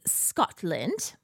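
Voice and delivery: badly faked Scottish accent, slowed down; horrible Scottish accent. Shown as a demonstration. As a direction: attempt a poor Scottish accent. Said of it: "That's my horrible Scottish accent".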